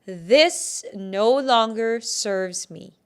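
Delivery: loud, slow, firm affirmation; slow declaration. Shown as a demonstration. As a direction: declare it with weight on every word. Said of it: "declare it one more time".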